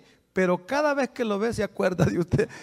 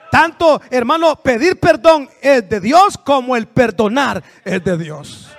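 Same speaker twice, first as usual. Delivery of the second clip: louder and slow, each word weighed; slow emphatic preaching; 4 semitones higher, 6 dB louder, slower than usual. Real, not acted. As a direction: preach it slowly with force. Preacher stressing forgiveness. (predicando fuerte y despacio)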